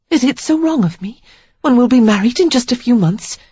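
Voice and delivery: Sarah, pleading. A narrator's reading. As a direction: plead to belong to him, voice lifted.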